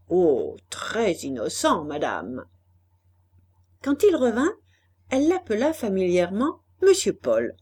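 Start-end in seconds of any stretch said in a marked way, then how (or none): 0.1-2.5 slow, lower man's reply; Brétigny answering. A narrator's reading. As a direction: answer low and drawn out, dropping away. Brétigny's sly-sounding answer to the lady. (réponse d'homme, lente et plus grave)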